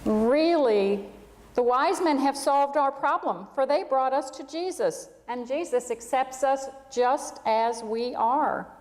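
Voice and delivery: put-on gentle storybook reading for children; story narration. A narrator's reading. Pastor reading a camel story to the congregation, storybook narration. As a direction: tell it like a bedtime tale to the whole room.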